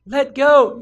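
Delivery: very high, loud incredulous yell; shrieked question; panicked disbelief. Acted. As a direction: shout it back in horror. Man incredulous, shouting back.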